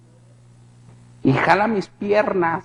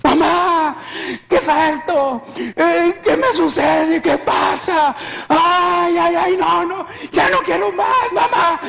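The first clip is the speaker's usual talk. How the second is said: high, wailing anguished cries; sufrimiento revivido; 12 semitones higher, slower than usual. Retold or imitated. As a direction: wail for mother in agony. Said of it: "yo estaba sufriendo tanto". (lamentos agudos de angustia y dolor)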